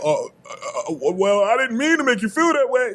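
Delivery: a stammering, sheepish excuse that jumps up high; mock flustered apology; caught-off-guard person. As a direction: play the one who got called out, fumbling. Voicing the other person caught out.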